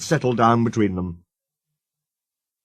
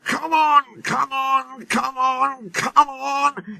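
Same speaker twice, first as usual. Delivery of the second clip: straining; much slower than usual. A narrator's reading. Wheels slipping, straining.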